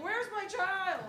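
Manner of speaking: high, slow panicked cry of a parent. Retold or imitated. Parent missing a child, panicked high cry. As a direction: wail it like a parent who lost a child. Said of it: "the parent's like".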